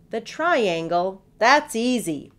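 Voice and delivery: loud, slow captain's bark; the captain barks it out loud and sure. Acted. Blustering pirate captain barks his answer loudly. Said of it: "barked Redbeard".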